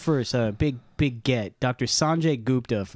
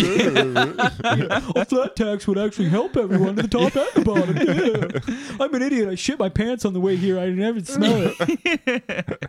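higher, slow dopey doofus voice; dumb guy voice; 7 semitones higher, slower than usual. Acted. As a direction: play a clueless idiot, laughing through it. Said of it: "repeat what they said in a dumb guy voice".

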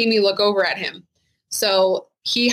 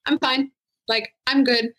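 starts much higher and chirpy, settling back down; fake fine; 4 semitones higher than usual. Officer retelling her fake-cheerful answer to colleagues, high then fading to normal. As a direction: brush it off with a forced bright smile. Retold fake reassurance after trauma.

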